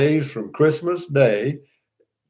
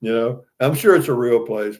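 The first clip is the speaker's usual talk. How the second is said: ordinary talk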